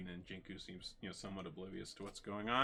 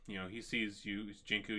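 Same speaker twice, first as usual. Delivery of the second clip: ordinary talk, nothing marked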